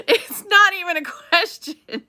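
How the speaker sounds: laughing it out, slow and high; can't stop laughing at how obvious it is. Real, not acted. Laughing to her viewers about the sheriff's obvious case, laughing.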